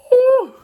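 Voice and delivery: high, loud victory whoop; triumphant whoop. Retold or imitated. Narrator whooping over his last punch, high and loud.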